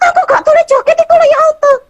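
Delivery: rengekan anak cepat melengking; suara anak merengek. Acted. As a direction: protes manja soal larangan joget. Anak merengek tak boleh joget. (rengekan anak yang dilarang joget)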